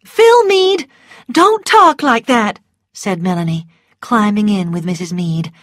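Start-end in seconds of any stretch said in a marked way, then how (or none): 0.1-2.6 high, loud reprimand; scolding. A narrator's reading. Melanie reproves Phil beside his mother, scolding. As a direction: cut the boy off firmly.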